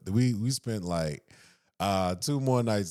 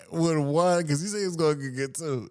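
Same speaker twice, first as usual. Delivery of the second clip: laughing; 10 semitones higher than usual. Real, not acted. Laughing hard telling friend about big bro's one drink. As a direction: crack up telling it.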